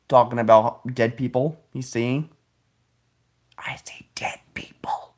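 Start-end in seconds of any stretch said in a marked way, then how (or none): 3.6-5.1 soft whispered Biden voice; Biden impression. Vlogger doing Biden quoting a ghost film, whispered. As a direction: breathe it out like a spooky old Biden. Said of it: "my best Biden impression".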